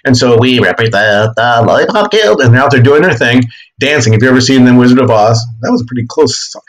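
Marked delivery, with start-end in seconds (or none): 0.4-2.4 slow, lightly voiced munchkin singer; a hint of a little Lollipop Guild munchkin. Teacher voicing the munchkins to the class, slow light munchkin voice.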